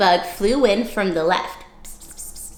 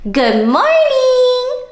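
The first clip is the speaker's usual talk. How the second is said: much higher, slow, sugary-sweet little bug voice; friendly ladybug; 5 dB louder, much slower than usual. Acted. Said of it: "said the friendly ladybug".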